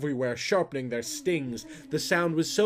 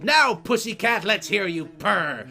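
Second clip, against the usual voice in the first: mean yell; 8 semitones higher, 7 dB louder than usual. Acted. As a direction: yell a cruel taunt at the cat. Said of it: "She just yelled".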